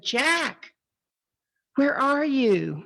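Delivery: slow, high call down to someone; acted searching call. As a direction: call into a canyon, stretching the words. Passer-by calling down to Jack.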